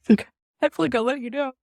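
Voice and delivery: the son tearfully confessing to his dad; choked-up apology; tearful retold confession. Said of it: "I cried to my dad".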